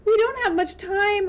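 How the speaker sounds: high, loud urging; urgent plea. Acted. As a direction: press him to act now. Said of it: "urged Catherine".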